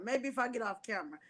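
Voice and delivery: ordinary talk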